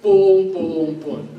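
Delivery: loud pum, pum, pum marking the beat like a drum; beat sounds. Shown as a demonstration. Teacher marks the rhythm's beats for students with loud pum sounds. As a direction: knock out the pulse with pum. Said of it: "si os habéis dado cuenta".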